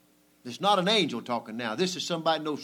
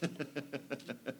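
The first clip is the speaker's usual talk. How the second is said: soft chuckle; 11 dB quieter than usual. Real, not acted. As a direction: laugh quietly to yourself. Chuckles at own remark.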